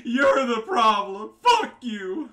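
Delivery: high, very loud mocking shout; mock ranting yell. Player parroting rival's rant to viewers, loud high mock yell. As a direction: imitate a rival losing it, high and stretched.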